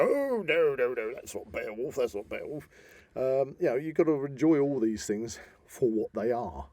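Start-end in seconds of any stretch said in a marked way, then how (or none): 0.0-2.7 mock purist. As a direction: poke fun at a pedantic fan's protest. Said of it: "and unless you're an absolute purist, and go".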